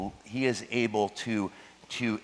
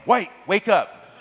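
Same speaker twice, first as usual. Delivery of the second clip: slow, loud, higher mock yell of a teacher; teacher's yell; 8 semitones higher, 6 dB louder, slower than usual. Teacher yelling at a daydreaming seventh grader, slow loud mock yell. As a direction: bark it like a fed-up teacher. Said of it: "my teacher would yell at me".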